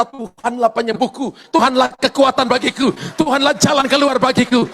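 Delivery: loud, rising declarations of faith; preaching at full force. Acted. Pastor urging the congregation to declare faith, preaching loud. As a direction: proclaim it to the whole church.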